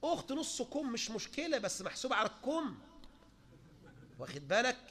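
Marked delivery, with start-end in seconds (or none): none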